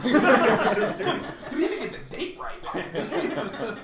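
high, loud and slow, breaking into laughter; mock the slacker through laughs; laughing mock disbelief. Mocking a teammate's empty report to friends, laughing high and loud.

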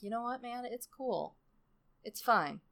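very soft, high at first; quiet forgiveness. Retold or imitated. Tortoise pretends to forgive Pig, very soft acted reassurance. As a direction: let him off gently, barely voiced.

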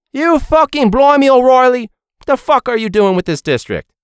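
high, loud British chef tirade; hammed-up angry British accent that slips; put-on British accent. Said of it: "I broke the accent".

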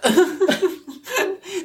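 high amused laugh; short laugh. Real, not acted. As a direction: laugh at yourself. Laughing before admitting accident.

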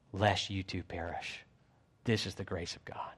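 low, soft closing appeal; hushed solemn preaching. Real, not acted. Preacher urging congregation to heed the gospel, hushed solemn preaching. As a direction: drop right down into a grave, quiet plea.

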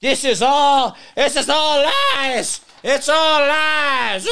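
high, slow, hammed-up whiny cry of "all lies"; mock her as a wailing, offended woman; mocking her outburst. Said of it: "How dare you question her?".

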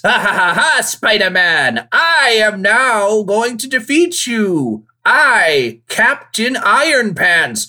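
slow, high villain gloat; drawn-out evil gloat, loud; unhinged villain. Retold or imitated.

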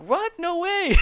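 very high, squeaky "What? No way"; can't believe the gift; shocked disbelief. Retold or imitated. Told he is getting a new grill, stunned and thrilled. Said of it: "I'm like".

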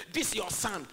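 THIS YOUR SON thrown out with disdain; derogatory. Retold or imitated. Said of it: "came talking in a derogatory way".

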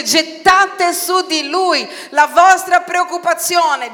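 loud, high, slow proclamation; forceful scripture quote. Acted. Preacher hurls a Bible command at the congregation, forceful.

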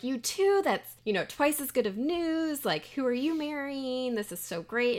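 high, gushing excitement; acted delighted surprise. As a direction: play a parent thrilled by double good news. Parents thrilled by second engagement.

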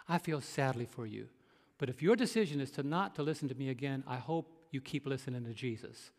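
retold sincere, subdued appeal; calm reasoning. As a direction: reason gently with an upset caller. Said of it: "to reason with her".

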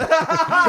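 loud, high cackle; high burst of laughter. Real, not acted.

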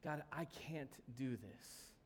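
soft, slow, low plea; giving up. Acted. Believer coming to Jesus overwhelmed, giving up. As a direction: confess it quietly and slowly. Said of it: "we come to Jesus and say".

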